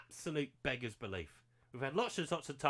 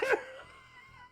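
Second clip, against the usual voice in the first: breathless squeal. Real, not acted. Laughing after goal.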